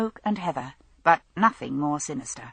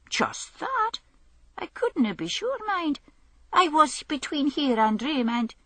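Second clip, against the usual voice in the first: much higher, uncertain tramp voice; hesitant; 10 semitones higher than usual. Acted. Tramp unsure telling Hamish what he saw, high and hesitant. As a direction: edge toward the answer nervously.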